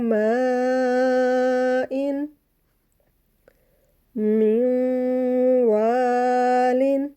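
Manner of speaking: मिन वालिन chanted very slowly with the नून hummed through the nose; slow tajweed recitation with nasal hold; nasal ghunna. Shown as a demonstration. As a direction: hold the nasal sound on वालिन for two counts. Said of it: "वाव मुशद्दद पे भी nasal sound बनानी है".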